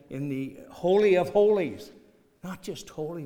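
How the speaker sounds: normal talking voice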